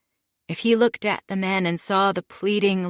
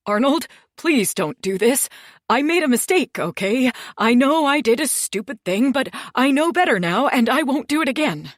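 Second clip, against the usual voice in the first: high, loud, frantic begging; desperate blubbering plea; 7 semitones higher, 5 dB louder than usual. Acted. Terrified man begging the boss for mercy. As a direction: beg for your life, voice climbing high. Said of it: "he immediately started blubbering".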